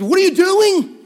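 high, loud panicked outburst, retold; freaking out. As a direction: act out a friend in a panic. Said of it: "like, freaking out".

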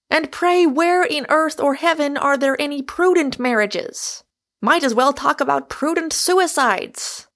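roaring. A narrator's reading. Michael demolishing Rosamond's case for prudence, roaring. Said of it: "roared Michael".

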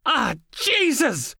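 high, slow, drawn-out yelp of pain; pained cry. A narrator's reading. Logan hit by his own pepper spray, crying out in pain. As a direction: let the sting drag it out.

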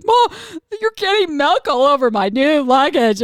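imitating a boy having a breakdown; bawling child. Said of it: "he starts bawling".

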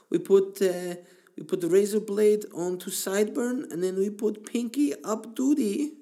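high, loud put-on Turkish accent in broken English. Turkish barber explains his trade in a Turkish accent. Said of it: "that's the way they talk there".

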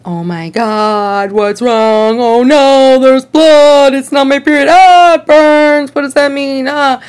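mock panic over the blood; freaking out. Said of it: "I was kind of freaking out".

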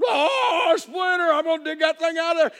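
hammed-up country comic panicking. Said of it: "old [name] does a routine".

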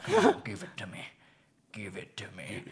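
very low, hushed menace; Debo. Retold or imitated. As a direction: demand it in a low voice, sinking to a whisper. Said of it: "Debo from Friday is like".

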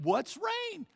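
high, baffled neighbor question; mock high-pitched puzzled neighbor; clueless neighbor. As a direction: play the dim neighbor who never heard of it.

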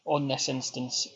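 low, polite call centre agent putting on the script. Pretend. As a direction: play a customer service rep explaining a refund policy. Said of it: "when you do your call centre voice".

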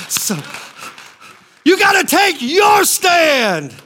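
hard-driving sermon delivery; full-force preaching. Real, not acted. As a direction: urge the congregation like a fired-up preacher.

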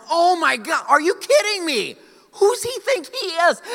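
high, loud, exasperated outrage at the other driver; outraged disbelief. Retold or imitated. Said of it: "And I thought to myself".

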